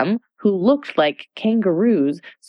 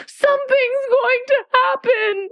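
panicked cry. Acted.

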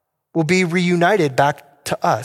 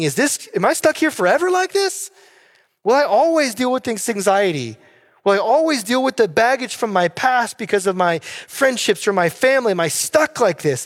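raised higher, a worried voice asking itself; anxious inner voice; 6 semitones higher than usual. Retold or imitated. A struggling believer asking himself, raised and worried. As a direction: voice the fearful doubts of someone stuck in anxiety. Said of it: "and you're thinking".

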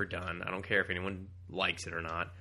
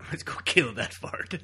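slow hammed-up villain; 4 semitones higher, 4 dB louder, slower than usual. Acting villain line.

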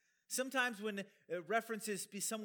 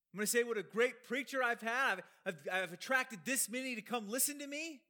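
louder, higher rhetorical questions; emphatic, raised-voice mock brag; mock boasting; 5 semitones higher, 5 dB louder than usual. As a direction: challenge them with raised, incredulous questions. Acts Paul scorning boastful self-promotion.

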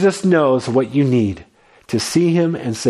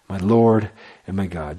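hushed reverence; 7 semitones lower, 3 dB quieter than usual. Acted. Preacher repeats Thomas's words in hushed reverence. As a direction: lower and softer, reverent.

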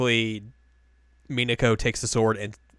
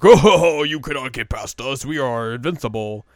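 boastful villains; 3 semitones higher, 8 dB louder than usual. Retold or imitated.

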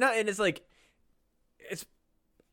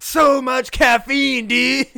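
slow, higher and louder manic voice; wide-eyed jittery ranting; frantic old man; 6 semitones higher, 9 dB louder, much slower than usual. Pretend. Old man explains his fitness, manic and loud.

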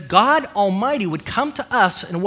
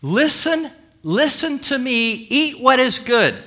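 emphatic call to listen; 4 semitones higher than usual. Acted. Sermon voicing God pleading, emphatic. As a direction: pastor voicing God urging the congregation, emphatic. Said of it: "the Lord says emphatically".

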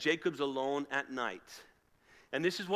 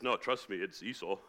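low, softer put-on voice; posing as Esau; 9 semitones lower, 4 dB quieter than usual. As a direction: insist quietly in a disguised voice. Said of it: "kind of sounds a bit like Jacob".

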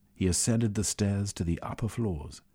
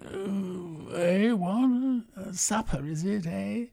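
higher, groggy mumble just woken; half-asleep muttering old man; 12 semitones higher, slower than usual. A narrator's reading. Half-asleep Velveen mumbling as supper is announced, groggy. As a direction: mumble it like a man jolted out of a doze. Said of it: "Velveen snorked and muttered".

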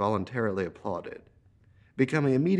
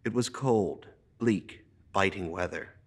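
plain reading voice

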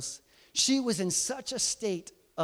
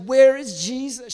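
high, loud mock wail; imitated crying; 8 semitones higher, 8 dB louder than usual. Preacher imagining Mary heaving, imitated tearful cry. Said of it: "I imagine her body was heaving".

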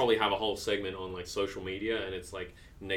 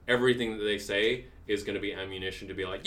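ordinary delivery

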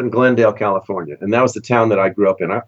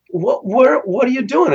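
much higher, eager questions; bursting with questions for old friends; retold thrilled surprise; excited surprise; 12 semitones higher than usual. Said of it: "so I go".

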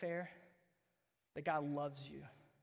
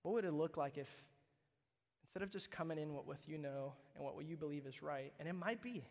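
ordinary delivery

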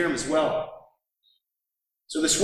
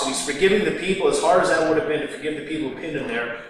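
ordinary talk, nothing marked

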